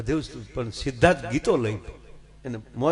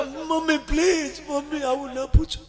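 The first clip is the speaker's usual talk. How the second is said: mock crying; 12 semitones higher than usual. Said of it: "પણ કુમાર તમે રડો માં".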